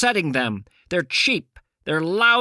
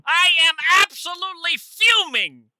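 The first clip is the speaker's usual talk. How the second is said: mock furious shout; 10 semitones higher than usual. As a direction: belt out mock fury. Prank phone call to listener.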